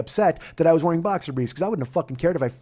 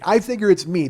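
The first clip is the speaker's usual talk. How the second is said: ordinary delivery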